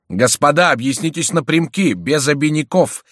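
loud, high demanding call; thundering voice. A narrator's reading. Мажерон demands straight talk from the gentlemen, thundering. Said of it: "вскричал Мажерон громовым голосом".

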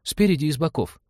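put-on high-pitched child's reproach; reproachful little boy. A narrator's reading. Boy faults the ship for having no gun, reproachful high voice. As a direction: chide like a small boy. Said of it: "упрекнул мальчик".

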